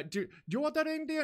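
quick, high-pitched imitated Indian accent; mock Indian accent, hurried question; thick Indian accent. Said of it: "thick accent, just goes like".

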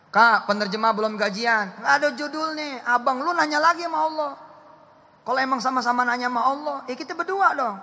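high, loud sermon voice; fiery preaching. Real, not acted. Preacher rousing the congregation, loud and high. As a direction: preach it hard and fast.

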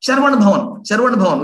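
imitating a gleeful demand for idli; eager call. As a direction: call out the restaurant name like a treat is waiting. President eagerly naming the restaurant.

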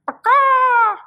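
high, loud squawking caw; piercing bird shriek; shrieking bird cry. Acted. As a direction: screech it like an angry bird.

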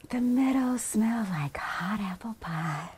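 soft, dreamy near-whisper; hushed savouring. A narrator's reading. Narrator relishing the peaceful meadow, hushed. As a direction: breathe it out softly, relishing the scent.

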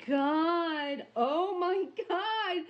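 high, slow, breathless "Oh my God"; awestruck gasp. Real, not acted. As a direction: let the awe lift the voice high. Awestruck at the unboxed piece.

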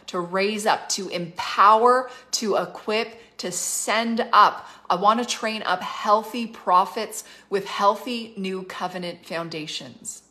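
emphatic preaching with rising force. Acted. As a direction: preach it, punching key words. Preaching climax, building.

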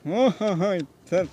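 ordinary talk, nothing marked

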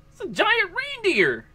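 high, drawn-out exclamation of surprise; real amazed reaction. Real, not acted. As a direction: call it out slowly in high-pitched astonishment. Startled by boss in game.

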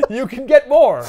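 high, loud pitchman payoff; hammed-up commercial shout; mock commercial slogan. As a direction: deliver a joke ad tagline, half laughing. Improvised fast-food commercial slogan.

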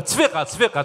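ordinary delivery

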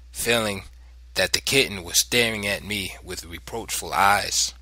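ordinary delivery

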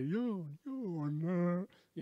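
mock his strange habit; imitating Ken's catchphrase. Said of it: "he used to go".

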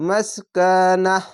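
slow drawn-out recitation of মাসকানাহ; Quranic recitation. Shown as a demonstration. Teacher reciting a word for Quran pupils, drawn out. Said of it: "নাহ, এরকম হবে".

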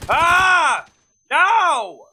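high yelled protest; frustrated outcry. Real, not acted. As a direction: cry out at losing, really upset. Upset at being killed in game.

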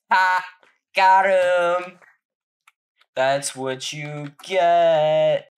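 loud, high victory taunt; triumphant gloat. Real, not acted. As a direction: gloat over the kill. Kills the attacker, gloats.

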